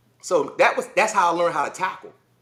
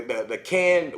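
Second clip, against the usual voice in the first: ordinary delivery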